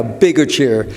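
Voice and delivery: ordinary delivery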